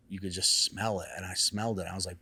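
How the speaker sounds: ordinary talk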